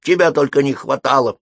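high cat voice, put out; meowing cat. A narrator's reading. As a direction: snap it as an annoyed cat. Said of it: "мяукнула она". (высокий голос недовольной кошки)

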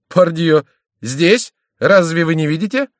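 high, loud, surprised questioning; astonished. A narrator's reading. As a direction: ask it high, in disbelief. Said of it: "удивлённо произнёс прежний голос".

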